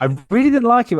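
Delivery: loud admission. Real, not acted. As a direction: blurt it out now that it's safe. Admitting it openly after encouragement.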